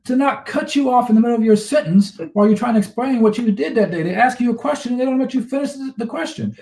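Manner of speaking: high-pitched, worked-up rant; riled up, voice pitched high; fired up. Real, not acted. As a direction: get fired up making the point. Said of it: "He's fired up today".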